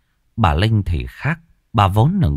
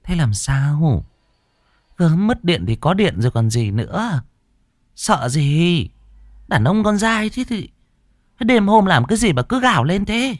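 higher, lightly voiced fretting mother; worried mother; 10 semitones higher than usual. A narrator's reading. Lightly voiced mother fretting over her frightened son, higher. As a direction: fuss over the scared son. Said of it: "Bà Linh nhìn con lo âu".

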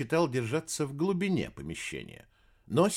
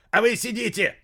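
high furious yell; angry shout; 11 semitones higher, 6 dB louder than usual. Acted. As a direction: lash out in anger. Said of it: "взорвался толстяк".

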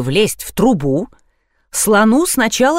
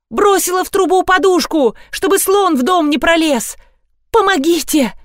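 shouting; 9 semitones higher than usual. A narrator's reading. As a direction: call down urgently. Yelling down from roof.

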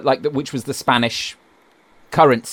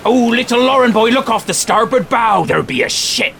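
louder, higher pirate captain; 7 semitones higher, 4 dB louder than usual. Pretend. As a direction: call it out from the ship's deck.

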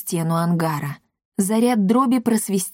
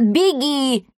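higher, urgent cry; 6 semitones higher than usual. A narrator's reading. Pilot quotes his survival instincts, crying out. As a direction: raise it into a mock alarm call. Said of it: "кричат".

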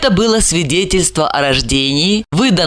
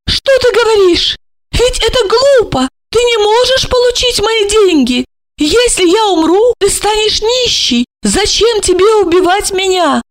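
high, anxious pleading voice; bewildered older woman; 3 dB louder than usual. A narrator's reading. Olga pleads with Marina, high and bewildered. Olga's voice in story.

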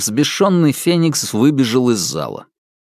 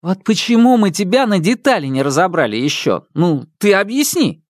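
higher sneering question; taunting; 6 semitones higher than usual. Acted. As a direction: dare him to explain. Brother taunts robot.

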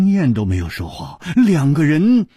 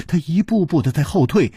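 ordinary delivery

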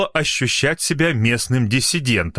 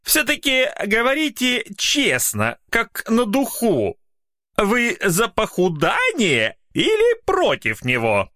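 put-on higher, fussy old man's voice; bald old man; 11 semitones higher, slower than usual. Bald old man questions Mykola, higher voice. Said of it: "плешивенький старичок в очках".

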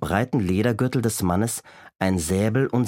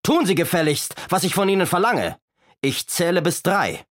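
high, commanding villain voice; bossy storybook robber, pitched up; Räuber Hotzenplotz; 8 semitones higher than usual. A narrator's reading. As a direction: order her about with blustering threat. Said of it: "rief der Räuber Hotzenplotz".

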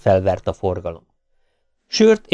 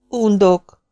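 indignant; 11 semitones higher than usual. A narrator's reading. Annoyed girl snaps at man.

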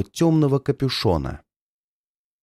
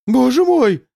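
much higher, louder shriek of terror; horrified cry; 12 semitones higher, 5 dB louder than usual. Acted. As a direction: scream as the ghost trick fails. Villain cries out in horror.

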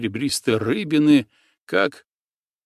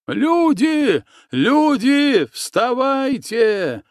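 much higher, louder yell, drawn out slowly at the end; wailing alarm cry; 13 semitones higher, 4 dB louder, much slower than usual. A narrator's reading. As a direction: howl the camp awake in terror. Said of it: "завопил проснувшийся Силантий".

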